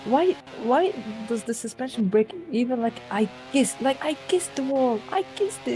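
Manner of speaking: indignant. Real, not acted. Protesting the crash, pitch way up.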